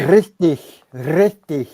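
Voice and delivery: Richtig with a rolled R; German rolled R. Shown as a demonstration. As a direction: roll the R in Richtig. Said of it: "The rolling R's in German".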